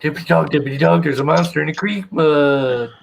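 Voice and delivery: lightly voiced old cartoon narrator; sing-song cartoon announcer, slowing down; vintage cartoon voice. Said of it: "You sound like a cartoon character".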